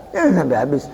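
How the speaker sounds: ordinary talk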